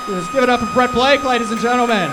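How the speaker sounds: shouting over crowd. Real, not acted. Comic to crowd during chaos, loud high shouted patter. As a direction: project over the roaring crowd.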